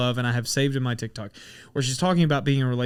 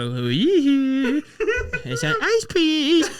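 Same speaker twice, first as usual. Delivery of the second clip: very high, slow squealing goof voice; silly voice; 13 semitones higher, 3 dB louder, much slower than usual. Retold or imitated. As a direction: squeal it like a cartoon. Silly voice on headset.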